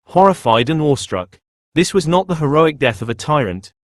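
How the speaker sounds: plain reading voice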